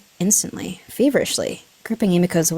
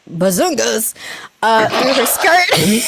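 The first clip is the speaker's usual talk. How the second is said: laughing; 11 semitones higher, slower than usual. Real, not acted. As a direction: fight back laughter. Laughs at own word choice.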